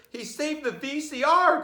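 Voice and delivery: high, loud joyful father; excited cry. Acted. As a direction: shout with delight. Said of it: "cried father".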